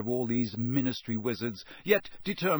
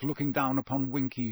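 ordinary delivery

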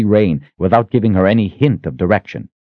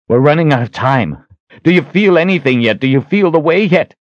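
higher, pressing questions; urgent; 9 semitones higher, 4 dB louder than usual. A narrator's reading. Richard pressing the Sister as time runs out, urgent. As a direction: push each question urgently, pitched up.

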